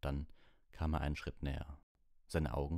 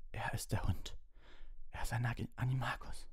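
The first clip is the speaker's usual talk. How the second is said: strained, high urgent whisper; in pain; 8 semitones higher than usual. Acted. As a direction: gasp out the warning. Injured Ron urgent warning.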